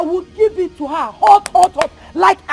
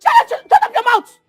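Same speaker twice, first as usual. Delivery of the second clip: angry shout; 6 semitones higher, 8 dB louder than usual. Acted. Quarrel outburst.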